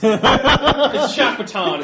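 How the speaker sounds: high, loud, slow through laughter; laughing. Real, not acted. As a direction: crack up while saying it. GM cracks up at the table joke.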